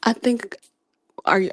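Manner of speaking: usual speaking voice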